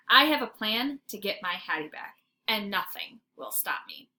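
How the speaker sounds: plain reading voice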